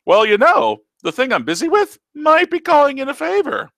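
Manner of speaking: put-on old uncle teasing his niece in a silly voice; teasing old Taco. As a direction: tease her like a cheeky ancient uncle.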